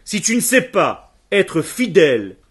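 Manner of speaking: normal talking voice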